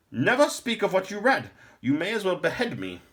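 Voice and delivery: put-on high, loud old man's voice; a fearful, stern lore master.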